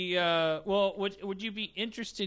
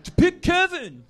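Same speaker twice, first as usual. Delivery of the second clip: mock heckler; 13 dB louder, slower than usual. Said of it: "They go like".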